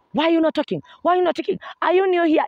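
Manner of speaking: high, insistent mock telling-off; berating director. Director to the silent new actor, high scolding. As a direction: fire off the questions like an irate director.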